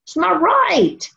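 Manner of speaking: high, drawn-out mock cry; defiant crowd voice. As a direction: cry it out high and slow, as the other side. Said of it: "Another part of the human race is saying".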